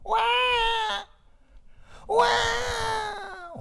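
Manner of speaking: very high crying wail; wailing baby cry. Acted. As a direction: wail like a hungry child. Neighbour boy crying.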